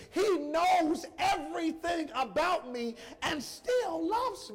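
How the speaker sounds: preaching at full force to the congregation; impassioned preaching. Acted. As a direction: lift it up and testify with full conviction.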